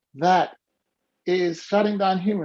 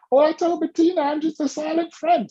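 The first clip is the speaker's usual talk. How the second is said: imitating Barbara; 9 semitones higher than usual. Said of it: "And she says".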